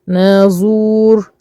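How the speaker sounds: نازور very slowly with both vowels held; elongated madd. Shown as a demonstration. Teacher giving an example word, slow stretched نازور. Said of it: "لازم نمد كده شوية".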